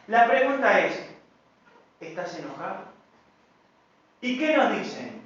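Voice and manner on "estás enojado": quiet question. Retold or imitated. Spouse's question acted out.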